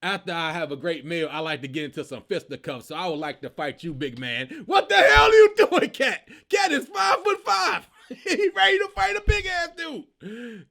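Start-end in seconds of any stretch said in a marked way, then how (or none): 0.0-4.6 lower, overly polite mock challenge; lightly voiced tiny man inviting a giant to fight; imitating Cat. Cat challenging the huge friend after the meal, low mock formal voice. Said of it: "Cat said". 4.7-6.3 higher and loud, half-laughing disbelief; disbelieving outburst. Real, not acted. Vlogger yelling at Cat for challenging the giant, loud disbelief. Reacting to Cat's fight challenge.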